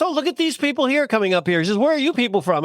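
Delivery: mock stage heckle. Comic to latecomers moving up front, mock heckle. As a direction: imitate a comic calling out the audience.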